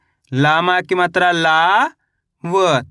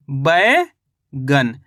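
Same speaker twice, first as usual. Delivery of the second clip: "बैगन" very slow, बै rising, long gap, गन falling; syllable by syllable; 7 semitones lower, much slower than usual. Shown as a demonstration. Teacher shows learners the finished word, slowly in two parts. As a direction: split "बैगन" into a stretched बै and a separate गन. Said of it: "तो मिलके बन जाएगा अपने ये".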